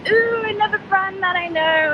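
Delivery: delighted squeal. Retold or imitated. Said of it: "So I'm like".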